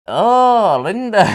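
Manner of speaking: drawn-out, high, loud stage cry; big melodramatic outburst; overwrought wail. Acted. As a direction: wail it out like an over-dramatic actor. Acted dramatic play scene slip.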